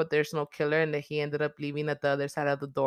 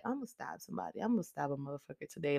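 much softer, muttered joking menace; hushed mock threat; 2 semitones lower, 12 dB quieter, faster than usual. Joking about her own catchphrase, hushed mock threat. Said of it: "to be like ... that be my words".

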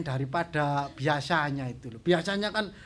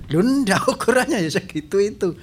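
loud, high shopkeeper insisting; 6 semitones higher, 7 dB louder than usual. Retold or imitated. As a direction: answer like a pushy shopkeeper. Imitates Chinese shopkeeper replying.

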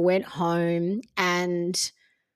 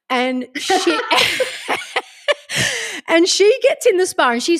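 laughing; 9 semitones higher, 7 dB louder than usual. Real, not acted. Laughing while describing friend.

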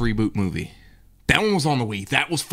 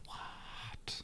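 whispering; 23 dB quieter than usual. Real, not acted. Unvoiced reply.

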